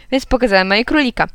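plain reading voice

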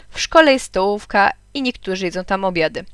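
ordinary delivery

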